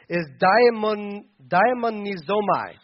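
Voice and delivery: slow, careful "daimonizomai" in syllables; Greek word spoken slowly. Shown as a demonstration. Pastor teaching the Greek "daimonizomai" to the church. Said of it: "The Greek word".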